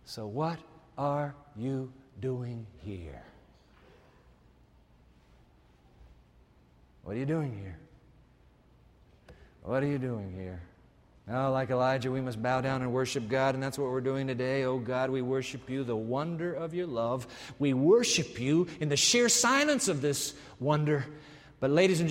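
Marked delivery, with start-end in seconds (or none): 0.1-10.6 soft searching question. Acted. God's question, soft and slow. 14.6-21.1 prayer. Acted. Prayer led by preacher.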